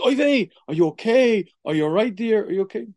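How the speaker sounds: high, loud, worried "oy vey" with Yiddish lilt; concerned wife. Acted. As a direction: fuss over him sweetly. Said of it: "let's call that scene one".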